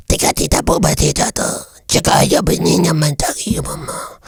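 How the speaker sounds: high, whispery gibberish creature; small, husky alien babble; little alien guy. Acted. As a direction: chatter like a tiny alien. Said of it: "the little guy who's like".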